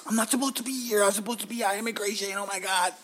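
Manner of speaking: high-pitched retold alarm; mock panic. Panicked retelling, much higher pitch.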